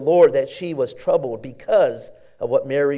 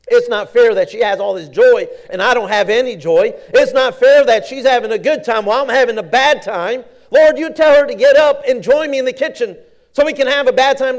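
high, loud petulant whining; building from complaint to loud whine; whiny Martha; 8 semitones higher, 8 dB louder than usual. Acted. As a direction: wail it like a sulking sister. Preacher acting Martha's rising complaint.

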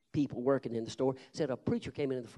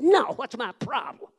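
higher and louder scoffing question; mocking retort; 11 semitones higher, 11 dB louder than usual. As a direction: toss the question back with scorn. Mocking reply to the preacher.

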